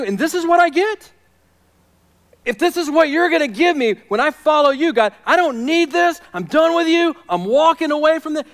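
high, loud outburst at God; fed-up protest. Acted. Preacher voicing people quitting on God, exasperated. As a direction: throw up your hands and protest loudly. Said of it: "throw their hands up and walk away".

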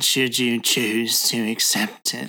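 slow, nasal villain imitation; nasal impression. Friend tries the film villain's mission line, getting nasally. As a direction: draw it out through a stuffy nose. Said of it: "It got nasally".

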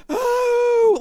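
high, drawn-out mock "oh"; exaggerated high moan of pleasure. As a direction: imitate a ridiculous ecstatic moan. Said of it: "making, like, the most ridiculous faces. They're like".

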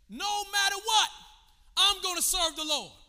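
loud, high declaration; preacher's shout. Shown as a demonstration. Preacher models an answer to pride, loudly declaring. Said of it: "screaming in my ear".

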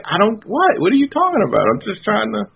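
high clueless voice; mock innocent. Tony answers Luke, high and falsely innocent. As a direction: play dumb in a high voice. Tony's reply, acted.

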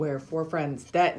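slow, even robot voice. Acted. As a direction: speak like a stiff machine. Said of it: "I was trying to do a robot voice".

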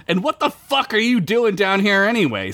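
high, insistent demand; indignant acted interrogation. A narrator's reading. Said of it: "Sid demanded to know".

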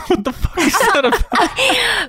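high, slow, laughing outburst; laughing disbelief. Real, not acted. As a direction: crack up while asking in disbelief. Cracks up at odd casting detail.

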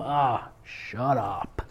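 low, soft, drawn-out brush-off; muttered self-rebuke. Acted. Man answering his nagging inner voice, slow muttered rebuke. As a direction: tell your own doubts off under your breath, slowly.

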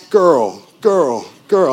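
loud, high, frantic repeated cry; panicked alarm. Retold or imitated. The boy's mind panicking when a girl says hi, loud high alarm. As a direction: sound the alarm like a panicking boy. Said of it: "the panic alarms are going off".

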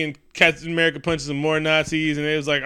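ordinary talk

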